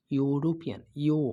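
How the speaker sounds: "ইউরোপিয়ান" with a long "ইউ", then "ইউ" alone; stressed initial "ইউ". Shown as a demonstration. Teacher pointing out the first sound, "ইউরোপিয়ান" then a separate "ইউ". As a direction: draw out the "ইউ" that opens "ইউরোপিয়ান". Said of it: "দেখছো ইউ উচ্চারণ হয়েছে".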